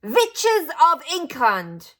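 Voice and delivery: slow, high, loud witch screech; Grand High Witch calling her witches to order; shouted. A narrator's reading. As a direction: summon the whole hall in a foreign witch voice. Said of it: "she yelled".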